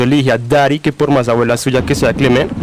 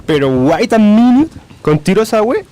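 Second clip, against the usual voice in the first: shocked disbelief; 6 semitones higher than usual. Acted. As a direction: stop her, stunned by the news. Grandchild shocked by the shooting.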